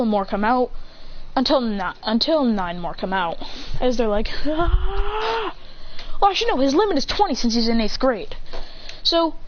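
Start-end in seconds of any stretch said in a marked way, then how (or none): 4.5-5.5 high, drawn-out mock yell; clones' battle cry. More clones come out, high drawn-out yell. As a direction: hold a high charging cry.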